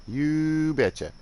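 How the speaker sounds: exaggerated Northern Midwest "You betcha." held long and high; broad Northern Midwest accent. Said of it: "they sound Canadian".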